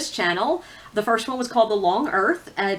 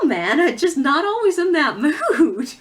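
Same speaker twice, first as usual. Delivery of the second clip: laughing; 6 semitones higher, 4 dB louder than usual. Real, not acted. Laughs at own mood excuse.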